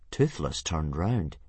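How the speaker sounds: usual speaking voice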